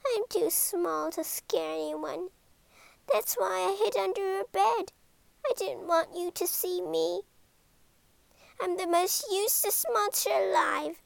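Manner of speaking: high, small voice in mild self-pity; tiny monster lamenting to the boy, mildly wailing; wailing little monster. Acted. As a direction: wail it like a tiny sad creature. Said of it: "she wailed".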